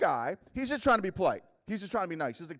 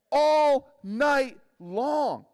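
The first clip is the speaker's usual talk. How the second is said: very slow, louder, higher; moaning about the endless night; drawn-out complaint; 9 semitones higher, 7 dB louder, much slower than usual. Real, not acted. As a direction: drag out all night long. Stretching the complaint.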